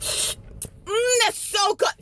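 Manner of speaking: high, soft savoring moan; exaggerated relish. Customer sipping in front of the woman, showy relish. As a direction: savor it showily. Savouring first sip to spite woman.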